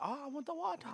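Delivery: very high-pitched mock Chinese accent; overplayed Chinese-restaurant accent. Customer echoing the waiter about water, squeaky mock Chinese accent. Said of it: "like, overplaying it now".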